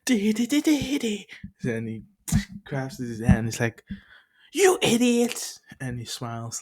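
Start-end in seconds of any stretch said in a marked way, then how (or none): 0.0-1.3 high, loud babbling; mock silly noise. Bakugo voiced in retold scene. 4.5-5.6 high, loud, slow insult; angry yell. Retold or imitated. Bakugo voiced in retold scene.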